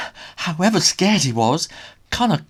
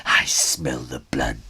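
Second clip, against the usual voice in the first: gloating ogre hush; 12 semitones lower, much slower than usual. Acted. Ogre gloating, sinking to whisper.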